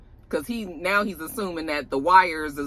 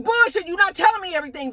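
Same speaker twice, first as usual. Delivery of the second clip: mock angry accusation; 12 semitones higher, faster than usual. [name] accusing Vic of holding back, in a fast high angry snap. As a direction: call his bluff hard.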